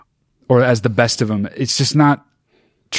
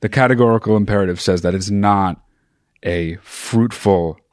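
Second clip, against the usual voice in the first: Agent Smith; 5 semitones lower than usual. Real, not acted. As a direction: draw out each word deliberately, pitch dropped. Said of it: "Agent Smith".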